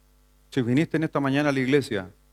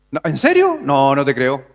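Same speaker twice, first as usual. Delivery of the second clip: doubting Thomas; 3 dB louder than usual. Retold or imitated. Thomas hearing that Jesus had risen, doubting. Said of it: "Tomás tuvo duda".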